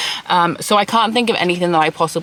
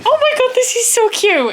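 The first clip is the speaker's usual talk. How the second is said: excited squeal; 5 dB louder than usual. Real, not acted. Delighted at cute room.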